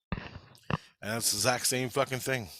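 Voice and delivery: ordinary talk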